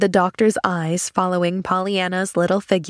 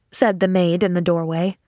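ordinary delivery

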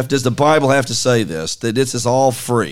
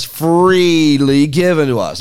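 "freely" stretched out and punched louder; stress on FREELY; 5 semitones higher, 4 dB louder, slower than usual. Real, not acted. Preacher showing his co-host what God has given, stress on FREELY. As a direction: lean long and hard on "freely". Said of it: "you emphasize the word freely".

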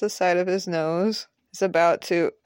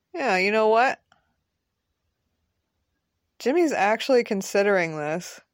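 ordinary delivery

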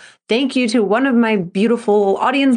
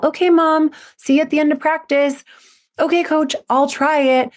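higher, put-on brave kid voice; forced cheerful kid; 8 semitones higher than usual. An anxious gymnast answering mom and coach, in a higher forced-cheerful voice. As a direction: sound cheerful while hiding the worry. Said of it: "just to put on a smile".

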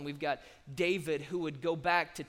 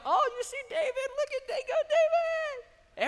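very high squealing fangirl voice; swooning girls; 6 dB louder than usual. Acted. Girls gushing over David the giant-killer, falsetto squeal. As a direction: squeal it in a mock falsetto, giddy. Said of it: "All the girls are like".

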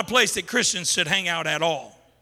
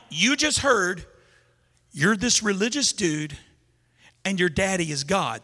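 ordinary talk

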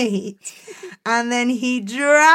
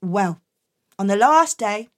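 plain reading voice

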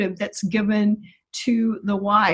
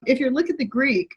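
ordinary delivery

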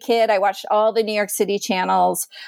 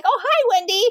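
higher, knowing mock-sweet mom; 9 semitones higher than usual. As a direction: mom greeting them as if nothing happened. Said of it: "she's like".

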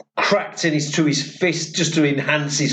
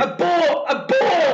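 shouted abort; 10 semitones higher, slower than usual. Retold or imitated. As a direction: bark the abort order. HQ calling off the mission.

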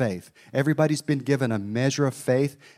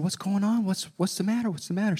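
alarmed concern; 9 semitones higher than usual. Retold or imitated. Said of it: "And I said".

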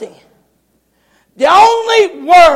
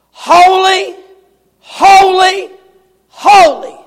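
steady higher, louder cry; crying out holy; 4 semitones higher, 4 dB louder, slower than usual. Acted. Seraphs crying endlessly in heaven, loud cry. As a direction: call it out like the seraphs. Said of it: "are crying out continuously".

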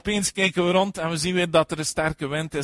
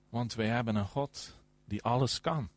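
usual speaking voice

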